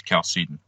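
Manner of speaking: Chalcedon said the English way, with an initial K sound; everyday anglicised "Chalcedon", K at the start; English pronunciation. Shown as a demonstration. Guest recommends the better way, English pronunciation of Chalcedon. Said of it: "better way to say it in English".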